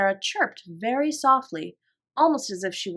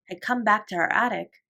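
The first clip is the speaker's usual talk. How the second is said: usual speaking voice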